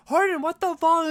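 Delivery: high, loud exasperated outburst; mock-indignant girlfriend, loud and high; outraged Tess impression. Voices Tess confronting Hardin.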